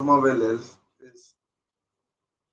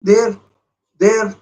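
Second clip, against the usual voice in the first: short loud higher calls; urgent shouts; 9 semitones higher, 9 dB louder than usual. Real, not acted. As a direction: point at the gap and yell. Urging a shot.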